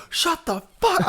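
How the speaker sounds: high, strained retold snap at the crowd; mock-furious retort without extra volume; pissed off. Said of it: "I'm so pissed".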